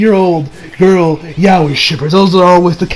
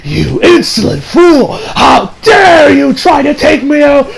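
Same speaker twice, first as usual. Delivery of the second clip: high, furious villain shout; Zod; 6 semitones higher, slower than usual. Retold or imitated. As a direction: bellow like a tyrant demanding respect. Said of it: "She's like".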